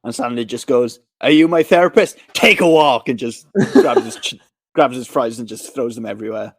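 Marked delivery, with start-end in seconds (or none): 1.2-3.1 louder, raised mock-angry brush-off; irritated retort. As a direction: imitate an annoyed dad telling someone off. Sandler's retort to critic, acted.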